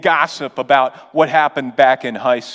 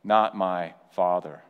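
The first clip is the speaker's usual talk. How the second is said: lower, softer "Not my father", musing; quiet wondering; 7 semitones lower, 6 dB quieter than usual. Retold or imitated. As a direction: pastor ponders the question aloud. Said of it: "Is he saying".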